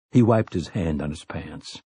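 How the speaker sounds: ordinary delivery, nothing marked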